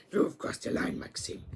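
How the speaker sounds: whispered threat; menacing whisper. Retold or imitated. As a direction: hiss the warning low and close. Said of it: "And then Gru's like".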